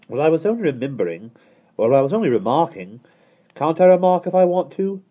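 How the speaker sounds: put-on peevish little boy protesting; child's cross whine. A narrator's reading. Michael getting cross.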